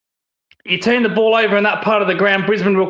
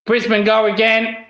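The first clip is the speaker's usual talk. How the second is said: usual speaking voice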